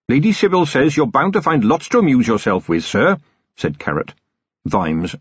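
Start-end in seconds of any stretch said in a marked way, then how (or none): 0.1-3.2 high, cheerful, apologetic voice; polite, eager young officer; earnest young captain. A narrator's reading. Carrot cheerful reassurance.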